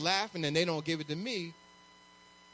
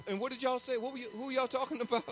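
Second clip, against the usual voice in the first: higher-pitched, quick nosy questions; nosy butting in; 4 semitones higher, 3 dB quieter, faster than usual. Retold or imitated. Preacher mimics himself barging into others' conversations, nosy. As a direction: play an eager eavesdropper, pitched up and hurried.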